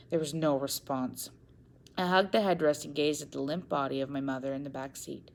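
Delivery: ordinary delivery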